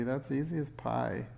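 ordinary delivery

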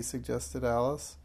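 usual speaking voice

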